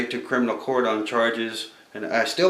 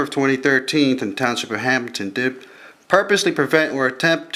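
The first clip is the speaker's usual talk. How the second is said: formal reading; 4 dB louder than usual. Shown as a demonstration. Reads out the formal charge.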